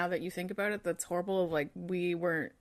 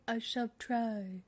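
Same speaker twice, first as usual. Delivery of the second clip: put-on slow, lilting, vaguely British accent; a drawn-out, higher mock-posh British lilt; lilty vague British; 4 semitones higher, 4 dB quieter, slower than usual. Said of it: "it's just sort of lilty".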